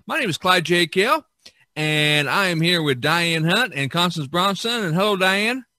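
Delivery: bright on-air podcast announcer; radio host intro. Acted. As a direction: open the show like a radio host welcoming listeners. Host opens the episode.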